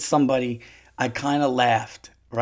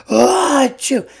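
high loud "Ah, achoo", much louder; imitated sneeze; 9 semitones higher, 7 dB louder, slower than usual. Mimicking the old man sneezing in Target, loud. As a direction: do the big fake sneeze. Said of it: "you just hear".

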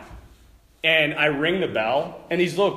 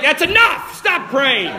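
mock stern cutoff; 9 semitones higher, 7 dB louder than usual. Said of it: "You know, like".

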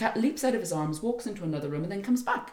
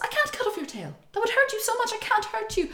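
distressed prince; 13 semitones higher than usual. Retold or imitated. Prince refusing his beloved.

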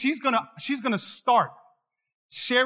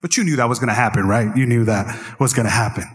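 lower, faster, mock-knowing; lower, quick mock I-told-you-so; knowing husband; 9 semitones lower than usual. Shown as a demonstration. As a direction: say it like you saw it coming, low. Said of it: "you gotta be like".

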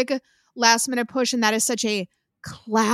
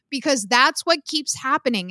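ordinary talk

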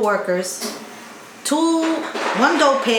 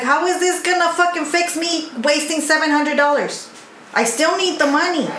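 angry; 7 semitones higher than usual. Retold or imitated. Retold angry demand to boss.

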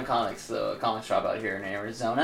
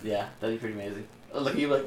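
normal talking voice

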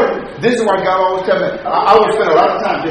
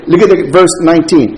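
ordinary talk, nothing marked

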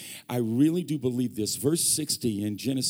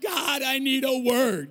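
slow, pleading shout to God; desperate cry; 10 semitones higher, 4 dB louder, slower than usual. Retold or imitated. Wife begging God aloud for a word, shouting. Acting out wife's desperate prayer.

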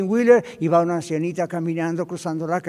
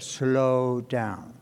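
slow, drawn-out, lower 'slow down'; 5 semitones lower, 4 dB quieter than usual. Acted. Preacher prescribing a remedy for overload, slowing down. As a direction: draw both words out to a halt.

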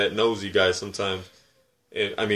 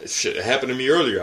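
ordinary delivery

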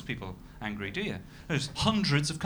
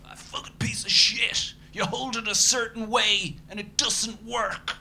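high, slow furious rant; ranting angry friend; 11 semitones higher, 4 dB louder, slower than usual. Retold or imitated. Friend livid about his iPhone, high slow furious rant. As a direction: spit it out like a livid mate with a broken phone.

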